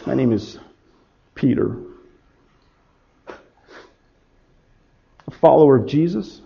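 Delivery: low, very soft and halting; hushed, heavy admission of guilt; ashamed confession. Acted. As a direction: confess it quietly, broken by long pauses. Peter confesses he is like Judas.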